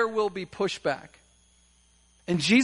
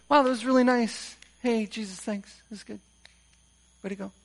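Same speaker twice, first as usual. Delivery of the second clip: mock casual praise; 3 semitones higher than usual. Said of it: "how could I be casual about this".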